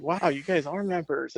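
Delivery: an amazed stranger, voiced up high; impressed surprise. Visitor surprised they are members.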